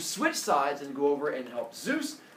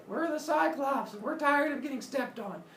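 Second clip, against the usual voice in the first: lightly voiced Cyclops; 9 semitones higher than usual.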